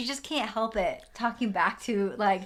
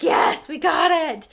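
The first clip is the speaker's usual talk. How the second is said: loud, high, drawn-out retold scream of joy; excited scream; 6 semitones higher, 9 dB louder, slower than usual. Cohost retells getting the advance book, excited scream. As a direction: yell it out slowly, thrilled. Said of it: "I screamed when I realized we got it".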